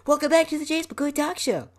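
very high, loud mock show intro; full-blast shout. His podcast intro blaring in class, high mock shout. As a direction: blare out a squealing podcast welcome. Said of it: "my voice just shouts out".

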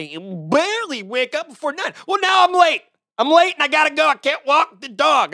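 high, loud exasperated argument; heated retort. Retold or imitated. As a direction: argue back fast and frantic.

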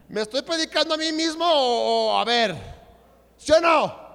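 high, very loud preacher's shout; loud challenge. Real, not acted. Preacher asks congregation if he preaches to himself, shouting. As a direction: demand an answer from the whole room.

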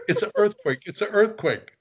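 high-pitched imitation of a panicked grandmother; mock alarm. Grandmother running out with a crucifix, high mock alarm. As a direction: cry it out twice as a retold warning.

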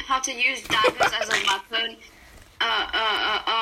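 ordinary delivery

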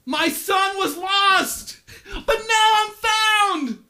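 high, slow, loud proclamation; a father shouting his joy to the world; joyful father's shout. Acted. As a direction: cry it out for everyone to hear.

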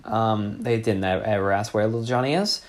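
ordinary delivery

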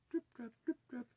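high, light pattering hoofsteps; very high little trip-trap sound words; tiny trip-trap. A narrator's reading. Narrator sounding the littlest goat crossing the bridge, tiny trip-trap.

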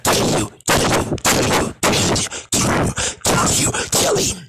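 imitated killer's chanted whisper; menacing whisper. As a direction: whisper the threat over and over like a horror character. Acting out Munch wanting him dead.

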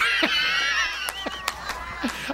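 high, shrieking burst of laughter; long, high-pitched real laugh; real belly laugh. Real, not acted. Laughs at his own story.

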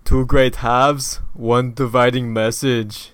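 put-on slow, heavy Austrian-accented movie tagline; Arnold accent. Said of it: "Now say it in your Arnold accent".

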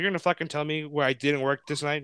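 normal talking voice

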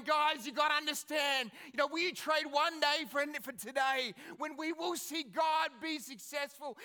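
loud, high rallying speech; King Jehoshaphat. Retold or imitated. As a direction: retell a leader urging his troops like a battle hero. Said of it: "he's standing there going".

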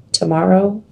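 usual speaking voice

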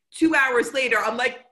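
ordinary talk

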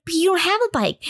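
hammed-up high-pitched bafflement; laying it on. Family teasing a child about a bike, mock bafflement. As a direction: lay on the fake puzzlement up high. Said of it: "They're really laying it on".

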